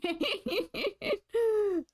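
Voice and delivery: high, squealing laugh of despair. Real, not acted. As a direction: break into a high, helpless laugh.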